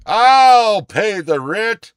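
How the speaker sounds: loud, high, slow heroic declaration; melodrama hero. Acted. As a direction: proclaim it like a dashing hero. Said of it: "I just did a play for y'all".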